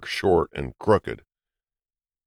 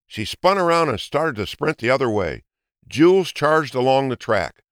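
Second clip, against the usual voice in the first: ordinary delivery, nothing marked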